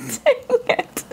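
laughing, whiny "Dang it"; half-laughing mock cry of "Dang it"; playful whimper of frustration; mock wail. Real, not acted. Misjudged a contestant.

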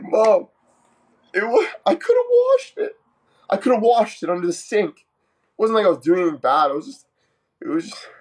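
put-on very high, whiny kid protest; hammed-up crying child begging mom; mock tearful pleading.

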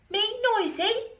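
very high, loud squawk; offended monkey. A narrator's reading. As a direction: squeal it in outrage. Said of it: "said the monkey".

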